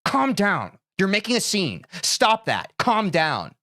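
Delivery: put-on curt, harsh parent scolding a child. Parent telling a tantruming kid to calm down, harsh. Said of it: "they grab them by their wrist harshly".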